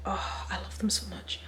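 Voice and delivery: real fond whisper. Real, not acted. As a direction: breathe it out like a secret love. Gushing about favourite band.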